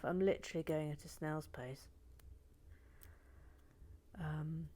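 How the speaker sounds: usual speaking voice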